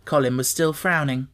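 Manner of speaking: ordinary delivery, nothing marked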